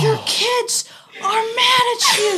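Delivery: high, slow scolding shout. Retold or imitated. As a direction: tell them off in a high shout. Teacher scolding the parents.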